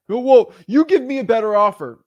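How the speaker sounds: high, loud pushy retort; hammed-up demand; mock demanding seller. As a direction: push back as the indignant seller.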